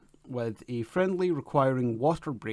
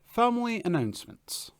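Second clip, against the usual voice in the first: announcer heading; 10 semitones higher than usual. Acted.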